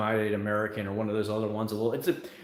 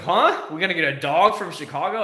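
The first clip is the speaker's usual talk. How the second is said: confused huh; 4 semitones higher, 5 dB louder than usual. Retold or imitated. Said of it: "my brain's like".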